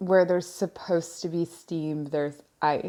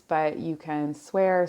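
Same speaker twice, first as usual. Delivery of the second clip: ordinary talk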